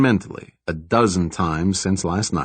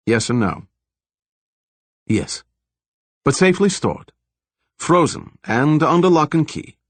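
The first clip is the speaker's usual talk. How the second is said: a hint of a calm, higher-pitched scientist with a slight foreign accent; lightly voiced scientist Zollner; 5 semitones higher than usual. The scientist coolly explaining the virus to the detective, lightly voiced. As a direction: lightly lift the pitch for the doctor, measured and matter-of-fact.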